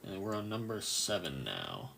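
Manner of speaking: usual speaking voice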